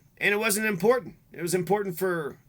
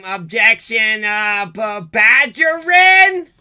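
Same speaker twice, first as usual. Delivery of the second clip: slow, drawn out, rising higher and louder; hammed-up clueless mother fumbling the word; mocking her; 3 semitones higher, 11 dB louder, much slower than usual. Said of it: "she'd be like".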